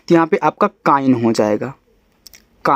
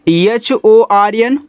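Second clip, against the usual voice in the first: spelling out letters; 4 semitones higher, much slower than usual. Shown as a demonstration. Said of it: "spelling out letters slowly".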